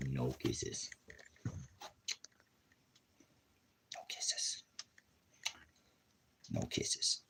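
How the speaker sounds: very soft, near-whispered refusal; hushed warning. Real, not acted. Telling the dog no kisses, hushed warning. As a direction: murmur it softly to hold the dog off.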